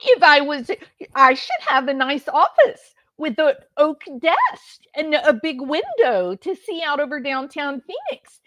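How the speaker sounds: high-pitched, hammed-up tearful whine; mock whining. Mocking a jailed defendant complaining to the judge, whiny fake sobbing.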